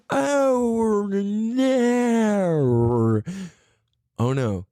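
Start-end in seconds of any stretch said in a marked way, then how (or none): 0.1-3.2 high Australian oh no; light mock Australian accent. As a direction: drag out the Australian "no". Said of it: "why do you talk like that?".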